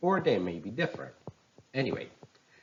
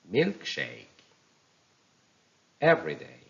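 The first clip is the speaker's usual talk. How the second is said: first part of each word punched, falling away; compound stress; 4 dB louder than usual. Shown as a demonstration. Teacher models compound nouns for learners, first-part stress. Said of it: "then you listen to my pronunciation".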